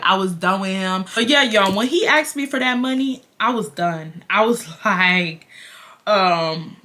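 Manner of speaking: normal talking voice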